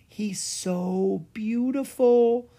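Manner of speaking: high, slow and awed; slow, hushed admiration; softly. A narrator's reading. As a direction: breathe it out in wonder. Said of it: "Clara said softly".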